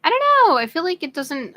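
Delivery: high, loud exasperated cry. Real, not acted. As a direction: throw up your hands in frustration. Unsure about her makeup.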